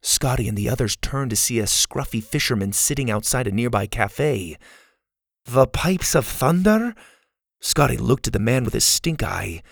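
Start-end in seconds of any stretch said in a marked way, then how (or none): 5.4-6.9 high, slow echo rising at the end; puzzled question. A narrator's reading. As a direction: repeat it in disbelief. Scotty repeats, doubting the fisherman.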